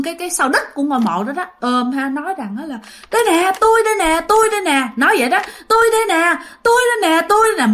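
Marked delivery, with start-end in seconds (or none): 3.1-4.9 high, pleading wail of a possessed man; imitating a crying man clinging to the grave; mock crying plea. Uncle hugging the grave calls out to family, high crying plea. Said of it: "mà ôm mà khóc nha". 5.7-7.7 high, pleading wail of a possessed man; imitating a crying man clinging to the grave; mock crying plea. Uncle hugging the grave calls out to family, high crying plea. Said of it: "mà ôm mà khóc nha".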